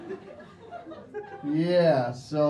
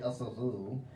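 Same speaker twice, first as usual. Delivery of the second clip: softer, snobbish clipped delivery; snooty Stureplan attitude; 3 semitones lower, 7 dB quieter than usual. Retold or imitated. Mocking posh Stockholm locals, lightly snooty. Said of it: "That Stureplan, little attitude there".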